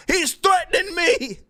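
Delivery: high-pitched imitation of a panicked accuser; mock alarm. Streamer mimicking the man who accused him, mock alarm. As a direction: whine it as a hammed-up victim.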